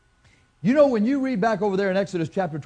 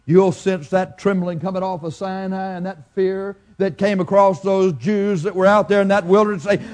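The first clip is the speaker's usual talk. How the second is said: ordinary talk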